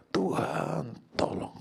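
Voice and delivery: high drawn-out first word, then dropping; imitated crying out. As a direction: act out David calling on God. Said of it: "Daud berseru kepada Tuhan". (seruan yang ditirukan)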